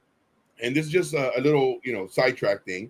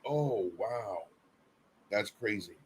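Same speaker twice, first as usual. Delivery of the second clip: dropped, soft, offhand mock wow; a hint of a bored onlooker; mock unimpressed reaction; 6 semitones lower, 13 dB quieter, slower than usual. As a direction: toss it off as a careless viewer. Mock reaction to viewers watching.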